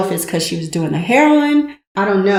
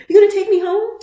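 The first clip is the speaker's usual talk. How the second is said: high louder echo; 13 semitones higher, 5 dB louder, faster than usual. Retold or imitated. Retold his echo, higher, louder.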